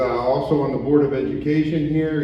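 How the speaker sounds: ordinary delivery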